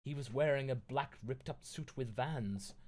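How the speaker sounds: plain reading voice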